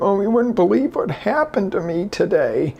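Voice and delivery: mock the victim's complaint; whiny victim. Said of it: "instead of".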